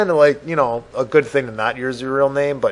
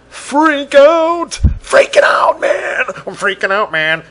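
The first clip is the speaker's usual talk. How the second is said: loud, high-pitched panicked shouting; hammed-up freak-out; 11 semitones higher, 8 dB louder, slower than usual. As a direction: yell it like a comic meltdown. Acting out a movie freak-out.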